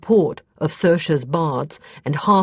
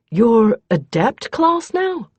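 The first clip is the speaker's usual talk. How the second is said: higher, slow and louder in disbelief; incredulous; 7 semitones higher, 6 dB louder, slower than usual. A narrator's reading. Justin to Kethri on learning her rank, incredulous. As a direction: stretch it out as if you can't believe it. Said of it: "Justin said incredulously".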